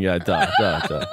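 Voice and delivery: lightly put-on Russian accent, Yeah turned into duh; a jokey Russian accent with a laugh in it; podcast host kidding around with a jokey Russian accent; mock Russian accent. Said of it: "Can you do a Russian accent".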